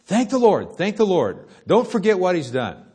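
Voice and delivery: high, loud proclamation; fervent scripture cry. Acted. Preacher urging the congregation: a loud, high, fervent cry. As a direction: call it out like a psalm.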